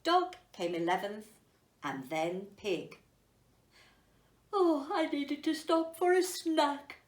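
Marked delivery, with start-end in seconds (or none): 4.5-7.0 higher, put-on pig voice; a higher piggy voice. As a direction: excuse yourself like a lazy pig. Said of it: "Pig explained".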